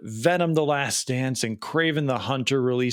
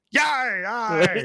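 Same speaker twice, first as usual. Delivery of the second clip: pirate grunt, higher and playful; mock pirate yar yar; hammed-up pirate; 8 semitones higher than usual. Joke-teller savouring the pirate image, hammed-up pirate. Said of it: "the visual of that".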